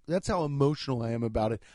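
ordinary talk, nothing marked

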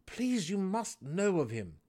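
high, urgent plea; pleading. A narrator's reading. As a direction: beg him to know. Drizzt pleading.

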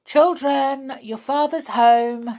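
calls loudly across the garden; calling out. Acted.

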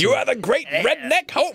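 high, pumped-up cheer; hype-up voice. Retold or imitated. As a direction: hype him up like a coach. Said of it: "That's how she hypes him up".

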